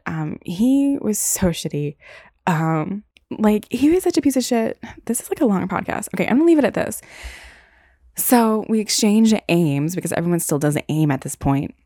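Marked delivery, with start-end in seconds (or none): none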